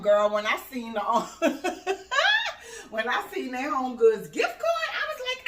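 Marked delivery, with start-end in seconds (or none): 1.0-2.9 high, delighted cackle; laugh. Real, not acted. Mom recalling her gift card, delighted high laugh.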